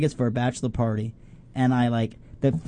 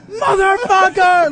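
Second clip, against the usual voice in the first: much higher, louder, stretched slow scream; mock scream; 7 dB louder, slower than usual. He shows friends the scream he almost let out. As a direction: howl it out in terror. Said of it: "I was this close to going".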